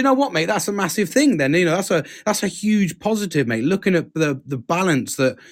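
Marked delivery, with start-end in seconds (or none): none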